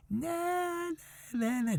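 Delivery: high, soft sing-song jeer; mocking taunt. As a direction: taunt him like schoolkids. Builders mocking the returning general.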